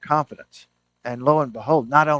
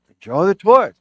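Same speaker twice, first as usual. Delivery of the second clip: cheery psychiatrist; 6 semitones higher, 8 dB louder than usual. Retold or imitated. Psychiatrist cheerfully presents toys.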